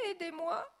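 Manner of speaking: high, loud call for help; crying for help. A narrator's reading. Director shouting to the family, cry for help. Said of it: "crie le directeur".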